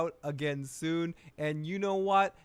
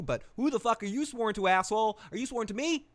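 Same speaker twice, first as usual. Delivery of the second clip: higher, louder furious demand; retelling Rhaenyra yelling higher and louder at Daemon; angry challenge; 7 semitones higher, 4 dB louder than usual. Acted. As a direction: shout it up into his face.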